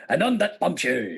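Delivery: lightly put-on star voice, laughter behind it; a loose, amused actor impression; Sean Connery. Host repeats the bombshell line on request, lightly voiced impression. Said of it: "Sean Connery or Gordon Cooper?".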